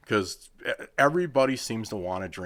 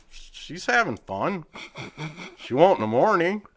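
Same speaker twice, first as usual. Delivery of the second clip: sarcastic; 8 semitones higher than usual. Retold or imitated. Quoting himself to board member.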